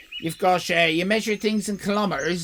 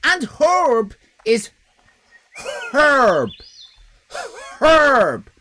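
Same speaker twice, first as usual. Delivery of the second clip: "herb" shouted with a strong sounded H, louder each time; hard H in herb; 8 semitones higher, 7 dB louder, much slower than usual. Shown as a demonstration. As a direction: bark "Herb" and hit the H hard. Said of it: "What do you think the H is doing there".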